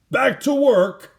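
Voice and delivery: high, loud, slow shout. Acted. Bulldozer driver shouting over his engine, loud high call. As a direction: bark the order to get back to work. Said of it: "he shouted".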